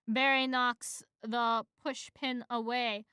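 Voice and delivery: ordinary talk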